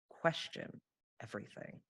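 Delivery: hushed, whispered motto. Real, not acted. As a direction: breathe it out like a secret. Whispered dramatic maxim.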